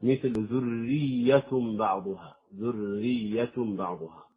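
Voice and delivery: ذرية بعضها بشدة الياء ببطء; شدد ياء ذرية ثم الباء; doubled ya. Shown as a demonstration. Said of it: "إذا جاورت الياء مشددا آخر". (تشديد متجاور ببطء)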